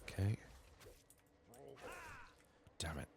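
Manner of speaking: normal talking voice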